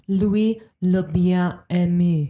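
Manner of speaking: slow careful French for "Louis le bien aimé"; French pronunciation. Shown as a demonstration. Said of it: "Louis the Beloved".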